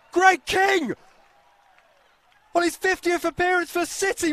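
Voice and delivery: high roaring shout of the scorer's name; goal yell. Real, not acted. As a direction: bellow the name out. Commentator calls a long-range goal.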